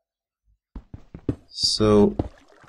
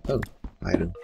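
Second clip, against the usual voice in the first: ordinary talk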